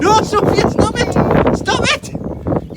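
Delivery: high squealing shout under a soaking; loud, very high, frantic plea; real yelling. Real, not acted. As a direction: shout in protest as water hits. Child spraying him with hose.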